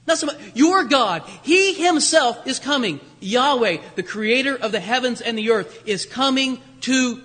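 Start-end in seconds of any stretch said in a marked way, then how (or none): 0.0-1.2 your pressed hard and louder; stress on your. Shown as a demonstration. Preacher to exiles, punching the word your. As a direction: lean on your, not a God but your God. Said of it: "Not a God".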